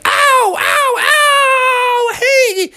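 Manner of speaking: high, yowling cartoon gator; high-pitched aggrieved creature voice; whining alligators. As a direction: wail in pain, then plead at high pitch. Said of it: "all the alligators were like".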